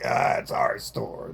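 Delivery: low, slow mocking clerk voice; imitating smug store clerk. Mocking the store staff who claim they own it, in a low put-on voice. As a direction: drag it out like a smug shop owner. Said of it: "And they're like".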